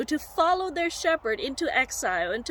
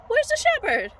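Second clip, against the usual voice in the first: high, loud voiced-up worried sheep; sheep's dawning alarm; 8 semitones higher, 5 dB louder than usual. Sheep notices the shepherd is gone, high mock alarm. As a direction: play a startled sheep, pitch jumping up. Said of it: "they started realizing".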